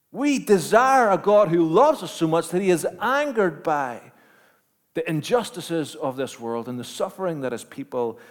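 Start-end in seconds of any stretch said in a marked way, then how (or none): 0.1-3.6 louder, higher, building sermon climax; preaching at full force. Acted. Preacher rousing the congregation about God's anger, full force. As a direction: drive it home to the congregation.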